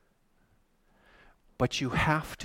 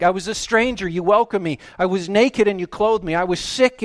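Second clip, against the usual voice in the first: higher and louder, ringing sermon delivery; full-force preaching; 8 semitones higher, 8 dB louder than usual. Acted. Preacher reading the king's words to the congregation, ringing and loud. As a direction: preach it out to the whole room.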